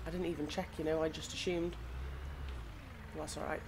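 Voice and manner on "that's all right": ordinary talk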